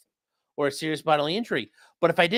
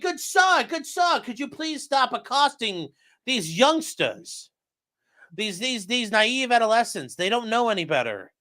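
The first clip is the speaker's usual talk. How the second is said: mock gentleman; 6 semitones higher than usual. Police imagined as polite gentlemen.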